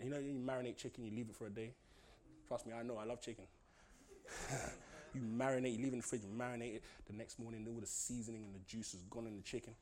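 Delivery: low, quiet preaching; soft intimate preaching. Acted. Preacher softly tells the church to believe, hushed and low. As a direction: draw them in, hushed and close.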